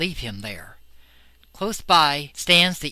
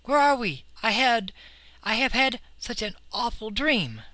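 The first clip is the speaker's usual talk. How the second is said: higher, shaken and bewildered; frightened boy; 8 semitones higher than usual. Acted. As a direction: wake confused and scared. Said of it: "breaks off with a cry".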